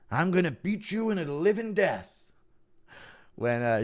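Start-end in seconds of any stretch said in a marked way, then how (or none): 0.1-2.1 imitated fighter's threat, high-pitched; mock a fighter threatening his rival; Shamrock threat. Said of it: "Shamrock was threatening Tito Ortiz".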